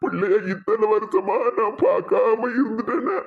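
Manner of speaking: acted tearful lament; a grandfather weeping with regret; crying. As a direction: sob through the words, wailing at phrase ends. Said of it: "நீங்க அழத பார்த்து".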